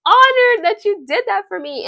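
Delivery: high, loud, hammed-up gush; embarrassing gushing. Nan Young thanking Jay for singing, overblown gushing. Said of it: "she embarrassed herself".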